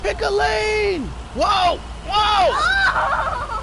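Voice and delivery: high yell at the bad driver; road rage yelling. Real, not acted. Reckless driving in game.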